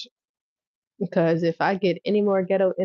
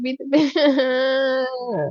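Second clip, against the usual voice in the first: slow quoted command; 7 semitones higher, much slower than usual. Retold or imitated. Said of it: "But she said".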